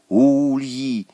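tentative schoolboy guess; unsure suggestion. A narrator's reading. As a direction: offer it uncertainly. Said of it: "неуверенно предложил Саня".

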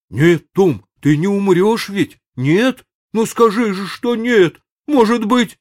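high, loud frightened begging; desperate pleading. A narrator's reading. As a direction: beg him not to die.

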